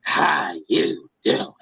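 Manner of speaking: very low, slow, drawn-out greeting; imitating the boss in a sinister voice; mock deep boss voice. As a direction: stretch it out low like a villain. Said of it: "had the nerve to look at me and say".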